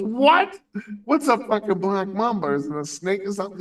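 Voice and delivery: high, loud disbelieving what; shocked question. Retold or imitated. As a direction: squeal it out in total disbelief. Friend's shocked question retold.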